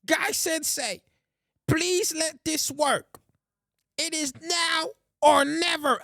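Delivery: high, drawn-out earnest anime plea; slow, high-pitched dramatic dub voice; Rock Lee. Acted. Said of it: "I see Rock Lee versus Gara".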